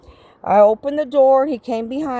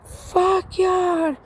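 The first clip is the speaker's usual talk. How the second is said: pitched-up shout kept at talking volume; retold yelling; 9 semitones higher than usual. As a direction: imitate distant yelling on a raised pitch. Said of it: "there was a bunch of yelling".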